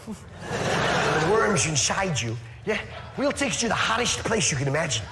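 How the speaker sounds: put-on Dutch accent. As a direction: tell it as a leisurely Dutch-accented pitch. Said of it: "hell in a Dutch accent".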